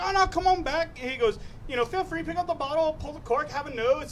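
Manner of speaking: high, lightly put-on Irish bartender; imitated Irish accent. Bartender urging a customer to sniff bottles, imitated Irish accent. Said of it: "when the guy became Irish".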